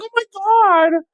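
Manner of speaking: very high, slow squeal of delight; mock gushing Maria. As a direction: gush like a swooning foodie. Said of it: "Maria's like".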